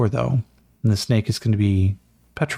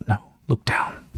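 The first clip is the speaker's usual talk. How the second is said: warning whisper. Acted. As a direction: whisper the warning, don't startle it. Warning quietly about the snake.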